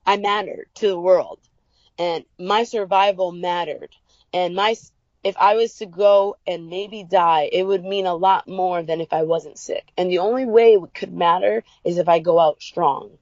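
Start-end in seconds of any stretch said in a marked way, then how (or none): none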